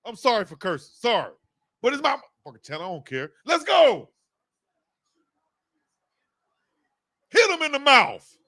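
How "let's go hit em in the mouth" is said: high-pitched rallying yell; fired-up cheer. Real, not acted. Fan urging defenders to hit, fired-up yell. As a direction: urge the defense on, full of fire.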